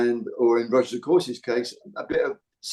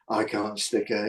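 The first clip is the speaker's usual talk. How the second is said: lower, softer dummy husband voice; low grumbling husband; 3 semitones lower, 5 dB quieter than usual. Retold or imitated. Imitating Sid who hates his wife, low voice. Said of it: "and the low voice".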